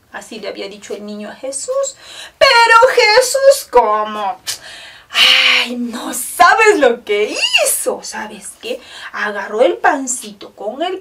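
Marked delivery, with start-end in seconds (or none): 2.4-5.7 excited storytelling. A narrator's reading. Storyteller builds suspense. 5.7-8.0 wonder-filled suspense. A narrator's reading. Storyteller builds suspense.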